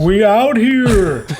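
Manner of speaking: high, loud, drawn-out holler; big carrying shout into the fog; booming yell. Acted. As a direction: call out slowly across a long distance.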